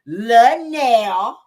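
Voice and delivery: loud, drawn-out mocking name; deliberately wrong name, sneered; with an attitude. Tisha needling Nell with the wrong name, snide. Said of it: "With an attitude".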